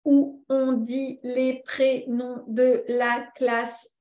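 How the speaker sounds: slow, one syllable at a time; half-speed robotic syllable-by-syllable delivery; robot talk. Shown as a demonstration. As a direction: chop every syllable apart like a robot. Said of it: "on parle comme des robots".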